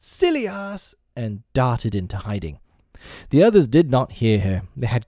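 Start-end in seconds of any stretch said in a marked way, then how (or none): 0.0-0.9 high-pitched shout without real volume; mock shout. A narrator's reading. Fairy jeering from overhead, mild high shout. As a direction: call out the insult high and light. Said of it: "Tinker Bell shouted".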